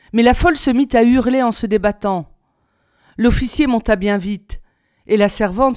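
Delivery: ordinary delivery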